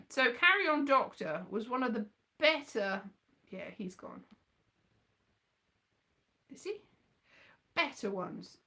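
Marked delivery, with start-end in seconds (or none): none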